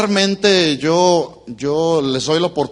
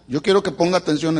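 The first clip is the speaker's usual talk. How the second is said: normal talking voice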